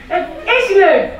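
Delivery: high, loud, slow shout; dad shouts at Henry, high, loud and slow. Acted. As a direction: drag out a cross shout. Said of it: "schreeuwde papa".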